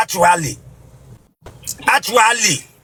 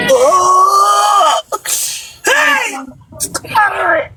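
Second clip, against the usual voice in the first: 1 wailing cry; 10 semitones higher than usual. Acted. Bishop's wailing outburst.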